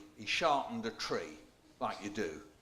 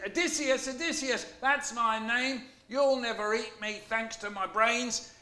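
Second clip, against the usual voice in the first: much higher, loud taunting call; Odysseus boasting to the blinded Cyclops; proud shout; 11 semitones higher, 4 dB louder than usual. A narrator's reading. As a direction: crow your name from the boat. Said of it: "so I shouted".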